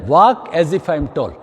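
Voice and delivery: slow, drawn-out mantra with a falling end; slow instruction. Shown as a demonstration. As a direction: say the rule slowly and firmly. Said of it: "वो एक thumb rule बनाए". (धीमा, खिंचा हुआ निर्देश)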